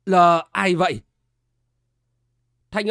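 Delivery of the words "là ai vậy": lightly voiced old man calling through the gate; old gatekeeper calling out. A narrator's reading. Old gatekeeper calls to whoever rang, lightly voiced old man. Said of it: "thanh âm ồn ồn của một lão già hỏi vọng ra".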